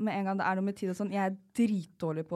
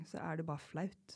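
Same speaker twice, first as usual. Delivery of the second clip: whispered, slow, softer; ashamed whisper; 3 semitones lower, 8 dB quieter, slower than usual. Real, not acted. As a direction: whisper it out of embarrassment. Ashamed confession. (skamfull hvisking)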